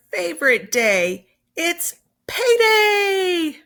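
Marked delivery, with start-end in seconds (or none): 1.5-3.6 high, slow, drawn-out cheer; gleeful payday cry; excited. Acted. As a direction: sing out the big news with delight. Teacher reading exciting payday line.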